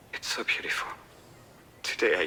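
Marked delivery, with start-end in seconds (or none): 0.1-0.9 hushed whisper, overcome. Real, not acted. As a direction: breathe it out, barely voiced. Son alone at the Lookout.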